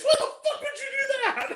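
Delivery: very high, laughing outburst; hammed-up movie freak-out; mock outrage. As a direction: squeal the question, breaking into laughter. Acting out film dialogue.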